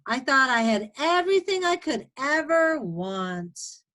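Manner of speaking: storytime narration for first graders; reading to children. A narrator's reading. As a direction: read it as a guinea pig's tale. Reads picture book aloud.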